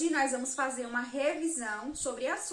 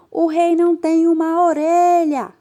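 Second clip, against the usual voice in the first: loud cry, a touch higher; shouting into a ditch; 4 semitones higher, 15 dB louder than usual. Acted. Barber shouting the king's secret into a ditch, loud cry.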